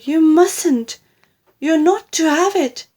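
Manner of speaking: high, loud, urgent protest; crying out. A narrator's reading. Grandson pulling the cup away, high urgent cry. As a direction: forbid her in a panic. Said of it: "George cried out".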